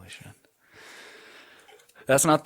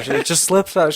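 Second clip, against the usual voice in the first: sheepish excuse pitched up high and louder; a mock-innocent, higher sing-song; embarrassed mom; 8 semitones higher, 6 dB louder than usual. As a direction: mom insisting it's natural, higher and louder. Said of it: "She just kept saying that".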